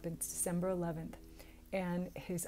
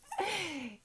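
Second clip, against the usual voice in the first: a sudden high giggle; high laugh; 12 semitones higher than usual. Real, not acted. Laughing at her own story, a high giggle. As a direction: burst into a real laugh. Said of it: "I'm cracking myself up".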